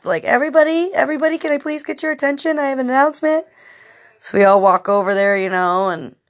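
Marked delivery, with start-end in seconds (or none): 0.2-3.5 high, fast call for the room's attention; a hint of a hostess announcing; party announcement. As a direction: tap the glass and address the party. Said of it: "somebody dinged a glass".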